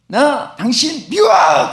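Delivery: put-on slow, drawn-out child's yell of I hate you; child's shout. Preacher acts out a child yelling at the mountain, a slow mock shout. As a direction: yell it slowly like an angry child. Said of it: "막 거기서 막 소리쳤습니다".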